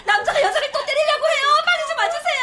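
high, hurried plea to the police; frantic police call. Acted. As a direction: rush the report while the fight goes on. Frantic call to police mid-fight. (다급하게 높은 목소리로 신고)

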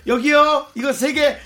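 high, loud eager calling; bubbly sorority girl. Acted. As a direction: shout the order giddily. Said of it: "과도한 리액션".

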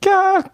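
very high fangirl squeal; excited squeal. Acted. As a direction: shriek with delight. Squeal in letter.

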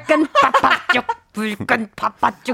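laughing imitation of foreign-accented Korean; mock foreign accent. Host teasing the player's attempt, mock foreign accent. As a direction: copy the odd delivery in a foreign accent, laughing. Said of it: "크리스티나 선배님인 줄 알았습니다".

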